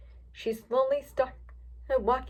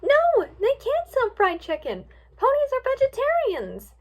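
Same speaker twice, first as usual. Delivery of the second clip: appalled protest; 13 semitones higher, 5 dB louder than usual. A narrator's reading. Twilight objecting to ponies selling chicken, appalled protest. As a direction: wail it in high-pitched disbelief.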